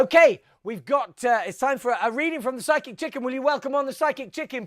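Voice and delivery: stage introduction. Acted. Host bringing on the Psychic Chicken, announcing. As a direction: welcome the act on with a flourish.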